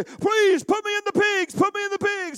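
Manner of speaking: high, loud begging demon voice; pleading demons. Retold or imitated. Demons begging Jesus, high loud pleading. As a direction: beg desperately, shrill and loud. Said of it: "the demons say".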